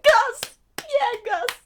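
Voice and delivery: high, loud fan cheering; re-enacted cheer. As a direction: whoop his name like a fan. Speaker re-enacts own cheering.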